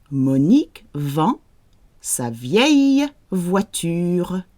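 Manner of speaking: slow, careful model pronunciation; slow model reading. Shown as a demonstration. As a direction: say it slowly so they can copy. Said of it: "So you say it out loud".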